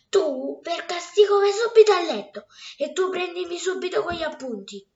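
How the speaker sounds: angry order. Acted. Wife orders girl and husband.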